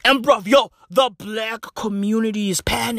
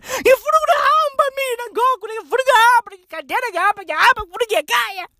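much higher, fast, sobbing Zulu wail; crying wail; 11 semitones higher, much faster than usual. Retold or imitated. Mocked Zimbabwean crying to go home, wailing. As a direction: whimper you want to go home. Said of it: "what happened to your proper Zulu accent?".

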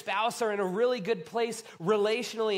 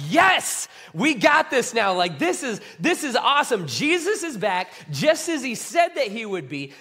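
loud, elated burst of celebration; excited disciple; 3 semitones higher, 8 dB louder than usual. Acted. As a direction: cheer it out like the best news ever. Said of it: "the excitement they were all feeling".

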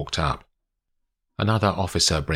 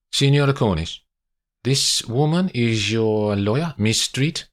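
slow, higher-pitched Italian officer's English; Italian policeman; 6 semitones higher, 4 dB louder than usual. Acted. Police officer asks who Olivia is, formal Italian-accented English.

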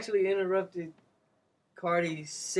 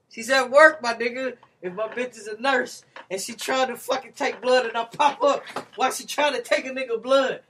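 heated; 6 semitones higher, 8 dB louder than usual. Real, not acted. Heated point about nurse.

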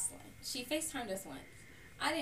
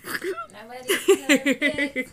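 laughing tease; 8 semitones higher, 15 dB louder, much slower than usual. Real, not acted. Teasing reply, laughing.

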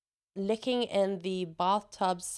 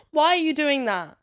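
higher and louder, demanding; exasperated shout; 6 semitones higher, 6 dB louder than usual. Acted. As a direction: throw the question at them loudly. Baffled by adults on scooters.

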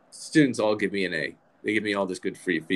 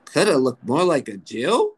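put-on higher, louder New York accent, incredulous; outraged New York mom; 4 semitones higher, 5 dB louder than usual. Mom reading the teacher's comment, outraged New York accent. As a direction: read it back as an affronted New York mother. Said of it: "reads through it, and she says".

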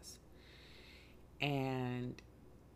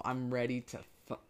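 ordinary talk, nothing marked

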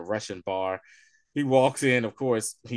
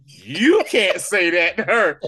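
slow, louder, higher firm rebuke; retold rebuke; 5 semitones higher, 7 dB louder, slower than usual. Fury telling Maria Hill's friend off, slow firm rebuke. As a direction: lay down the law, drawn out.

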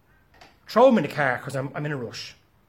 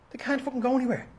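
ordinary talk

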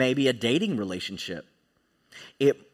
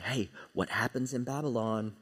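hushed, low tempter's voice; soft, low mock whisper; whispering Satan; 4 semitones lower, 7 dB quieter than usual. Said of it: "Satan all the while is whispering to them".

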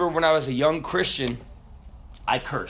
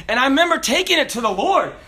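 ordinary delivery

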